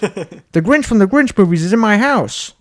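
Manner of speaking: loud, high cartoon dad impression; Peter Griffin. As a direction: blurt it out in hammed-up amazement. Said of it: "Peter's like".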